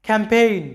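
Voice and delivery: ক্যাম্পেইন said "cam-pain", g silent; English word campaign. Shown as a demonstration. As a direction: model ক্যাম্পেইন clearly. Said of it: "এরপরে দেখো".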